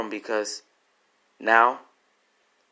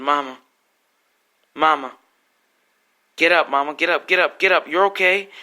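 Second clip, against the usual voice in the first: climbing higher with each get up; begging, rising into panic; desperate pleading; 4 semitones higher, 3 dB louder than usual. Retold or imitated. Son begging his dying mother to get up, panicked pleading.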